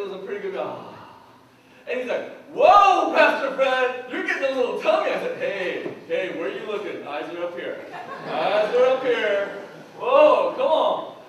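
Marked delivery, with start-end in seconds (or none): 2.5-5.1 loud, laughing jab about a tummy; a loud teasing whoa; teasing exclaim. Retold or imitated. Young adult teases pastor at lunch. 5.4-11.2 playful indignant protest with laughs; hammed-up wounded pride; mock offended. Pastor's mock-offended retort.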